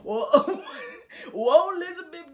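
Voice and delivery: high, loud hammed-up swoon; mock keeling over with wailing whoas, laughing; comic death-scene cry through laughter; dramatic collapse. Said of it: "the way she tells the story is that I was like".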